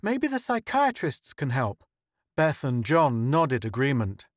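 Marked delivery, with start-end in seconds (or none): 0.0-1.8 high, hopeful lift. A narrator's reading. Joe suggesting the psychiatrists to the crew, hopeful. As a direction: offer the idea with eager hope. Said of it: "Joe said hopefully".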